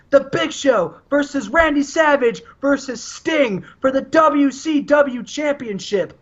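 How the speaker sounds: hammed-up wrestler promo to rivals; mock Sting promo. Sting calls out Savage and Big Show, hammed-up promo shouting. Continuing Sting's challenge to Randy.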